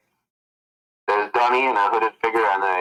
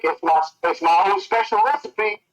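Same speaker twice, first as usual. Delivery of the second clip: cheery high voice; 7 semitones higher than usual. Acted. Frank voiced proudly.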